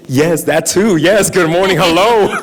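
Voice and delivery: laughing. Real, not acted. Audience greeting, laughing along.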